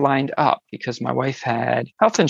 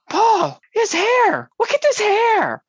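much higher, drawn-out cry of amazement; astonished calling out. Retold or imitated. Wife calling her husband to see the hair, astonished. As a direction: call your husband over in disbelief.

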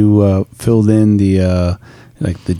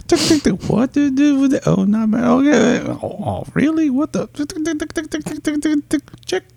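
high mock chatter; 3 dB quieter than usual. Imitating browsing profiles.

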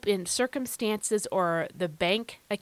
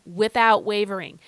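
louder, emphatic "without wavering"; outside voice; 6 dB louder than usual. Shown as a demonstration. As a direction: proclaim "without wavering" out loud. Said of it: "use my outside voice when I say those two words".

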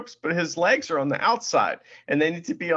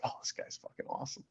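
fast, barely voiced murmur; hushed fan aside; 17 dB quieter, faster than usual. Friend brought along to the wrestling, hushed impressed aside.

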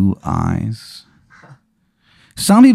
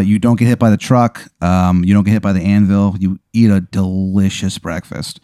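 ordinary talk, nothing marked

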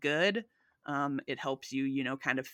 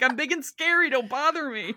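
high, loud mock-tough bluster; puffed-up tough voice; 8 semitones higher, 6 dB louder than usual. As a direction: puff yourself up like a scared bird. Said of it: "Being like".

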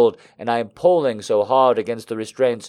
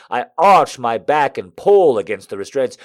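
plain reading voice

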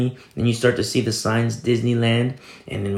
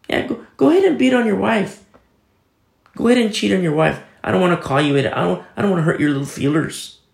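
sarcastic; 7 semitones higher, faster than usual. Acted. Preacher mocking those who call truth mean, sarcastic. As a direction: sneer it as fake permission.

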